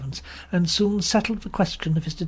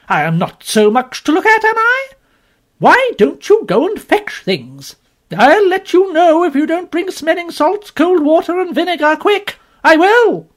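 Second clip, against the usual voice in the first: much higher, much louder bossy woman; scolding shout; 13 semitones higher, 9 dB louder than usual. Acted. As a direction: hammer orders at idle servants. Strong woman scolding inn servants.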